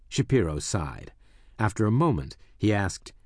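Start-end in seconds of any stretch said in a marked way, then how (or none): none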